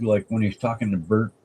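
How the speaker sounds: ordinary talk, nothing marked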